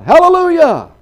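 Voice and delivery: sudden high, very loud cry of praise; hollered praise. Acted. Old farmer reading a second book, hollering out. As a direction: burst out hollering with joy. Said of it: "he hollers out".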